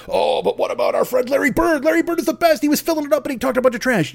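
high, quick barstool fan; pushy fan voice. Retold or imitated. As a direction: rattle off Larry Bird praise.

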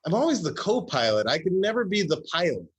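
mock frustration. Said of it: "I was like".

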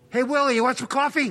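high, mock-casual duck voice; a duck calling out to a hunter; duck hunter impression. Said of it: "My impression of a duck hunting a human being".

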